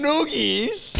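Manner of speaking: disbelieving shout. Real, not acted. Co-host reacts in disbelief.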